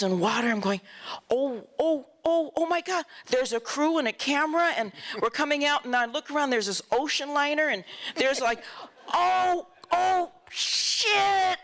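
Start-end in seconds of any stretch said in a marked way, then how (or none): 1.3-3.0 rising, alarmed oh oh oh; startled exclamation. Retold or imitated. Rider bursting through set onto Fellini's crew, startled. 9.1-11.6 shocked cry. Retold or imitated. Rider suddenly facing an ocean liner set, shocked high cry.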